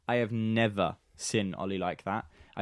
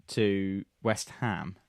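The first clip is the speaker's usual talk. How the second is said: ordinary talk, nothing marked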